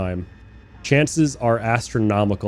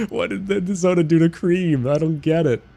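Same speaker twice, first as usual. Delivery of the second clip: baffled; 7 semitones higher than usual. Real, not acted. Still baffled by creaming soda.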